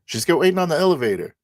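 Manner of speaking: high, loud, rapid in-character reply. Acted. Player answers where Isabella is, high urgent character voice. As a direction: fire back high and fast.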